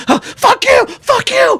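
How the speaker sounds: cursing strangers who walk past; angry cry. Retold or imitated. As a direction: howl it at people walking past. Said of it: "I'm like".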